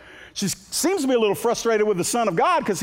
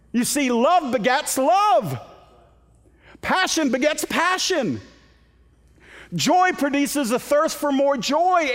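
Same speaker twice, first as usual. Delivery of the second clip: rising preaching; 9 semitones higher, slower than usual. Real, not acted. Preacher builds on intimacy theme.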